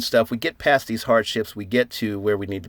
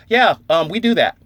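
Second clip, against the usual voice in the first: casual reply; 6 semitones higher, 6 dB louder than usual. Retold or imitated. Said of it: "Brodor was like".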